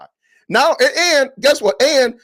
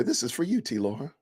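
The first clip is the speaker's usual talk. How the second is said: mock dedication; 6 semitones lower, 10 dB quieter than usual. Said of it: "And I'm gonna say".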